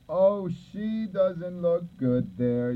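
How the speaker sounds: high, slow drawn-out dismay; dismayed surprise. Real, not acted. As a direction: let it out slowly, disappointed. Dismay at a drawing.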